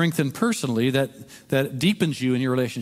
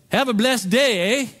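Canadian yell; 9 semitones higher, 4 dB louder than usual. Retold or imitated. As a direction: call it out like a cheerful Canadian driver. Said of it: "and yells".

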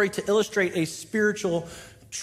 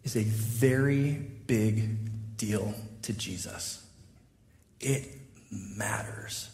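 solemn hush; 11 semitones lower, 7 dB quieter, much slower than usual. Real, not acted. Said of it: "This idea of forgiveness".

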